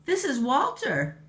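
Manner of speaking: high fairy voice. Acted. Tooth Fairy's voice in a story.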